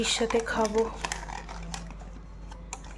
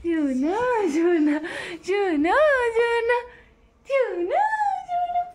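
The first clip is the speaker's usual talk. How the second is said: each sona climbs higher until a squeal; rising calls; 11 semitones higher, slower than usual. Real, not acted. Mother calling her baby, pitch climbing each call.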